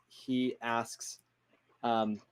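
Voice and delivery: ordinary talk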